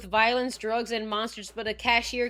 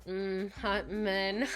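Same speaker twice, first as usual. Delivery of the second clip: slow, savoring murmur of appetite; slow, hungry mm; lip-licking relish; 4 semitones lower, 5 dB quieter, much slower than usual. Acted. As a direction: drool over it, drawn out and softer. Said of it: "slowly lick your lips".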